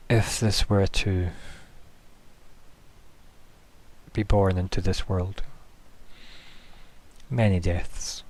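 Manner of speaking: slow, grave pronouncement with long pauses; solemn guardian. Acted. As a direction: weigh each phrase like a quiet warning. Grave warning voiced for Grace.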